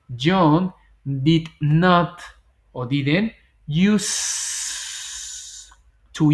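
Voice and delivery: each word stretched apart; very slow demo. Shown as a demonstration. Teacher modelling negation exercise answer, very slow. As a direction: draw out John did not slowly. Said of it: "Y digo".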